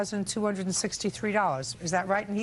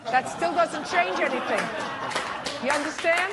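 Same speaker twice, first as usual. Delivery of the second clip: sarcastic scolding tv judge; 7 semitones higher than usual. Real, not acted. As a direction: press him until he agrees.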